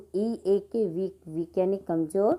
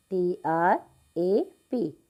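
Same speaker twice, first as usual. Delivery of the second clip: slow spelling; much slower than usual. Shown as a demonstration. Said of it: "spelling out trap".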